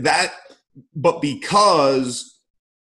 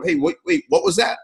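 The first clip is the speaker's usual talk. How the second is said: higher-pitched confused 'what was that?'; confused player; 8 semitones higher, faster than usual. Acted. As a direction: act out a player turning round, puzzled. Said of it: "now you're turning around saying".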